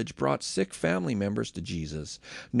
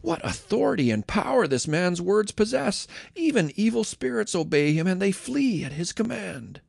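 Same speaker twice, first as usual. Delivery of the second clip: higher, wondering exclamation; amazed exclaiming; 7 semitones higher than usual. Acted. Villagers marvelling at Jesus's power over spirits, amazed. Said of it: "Amazed, the people exclaimed".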